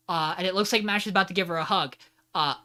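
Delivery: normal talking voice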